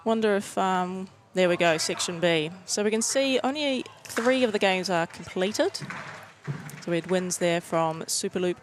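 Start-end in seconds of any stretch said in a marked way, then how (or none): none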